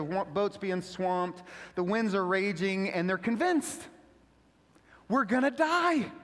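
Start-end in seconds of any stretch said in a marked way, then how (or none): 5.1-6.1 panicked cry. Retold or imitated. Disciples panicking in the storm.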